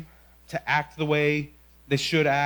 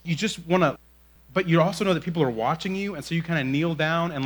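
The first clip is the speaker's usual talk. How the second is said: usual speaking voice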